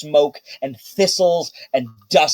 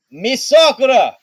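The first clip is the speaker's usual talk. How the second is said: Baco calling; 8 semitones higher than usual. Acted. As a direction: call out brightly to Miss Sakura. GM voices Baco handing dog.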